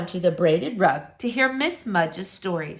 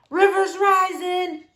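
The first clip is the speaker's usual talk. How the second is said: high, urgent holler; hollered warning; 11 semitones higher, 4 dB louder than usual. A narrator's reading. Big Sam warns the sandbag crew of the river, hollering. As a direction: call it out high over the noise. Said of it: "Big Sam hollered".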